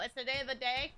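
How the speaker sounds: ordinary talk, nothing marked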